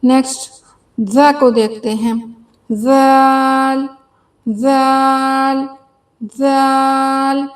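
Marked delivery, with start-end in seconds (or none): 2.7-7.5 slow soft zaal. Shown as a demonstration. Teacher modelling a soft जाल slowly. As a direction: stretch जाल long and slow. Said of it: "बहुत ही नरम पढ़ना है".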